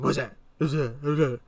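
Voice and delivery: mock-angry repeated demand; imitated angry cop. Cop grilling teen about the bottle, angry. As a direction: mimic an irritable officer firing off questions. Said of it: "talking like an angry person".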